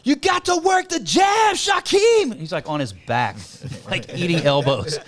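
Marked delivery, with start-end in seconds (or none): 0.0-2.4 very high, drawn-out yell; crowd heckler shout. Retold or imitated. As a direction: imitate a buddy hollering advice from the stands. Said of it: "one of Shaquem's boys in the crowd".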